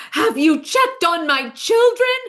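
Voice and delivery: hammed-up offended mother demanding an answer; indignant demand.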